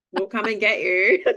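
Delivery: laughing. Retold or imitated. Quote then laughter.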